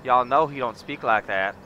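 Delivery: southern ranch talk. Acted. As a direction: lay on a big Southern accent, welcoming a partner. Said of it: "I could go all southern on you".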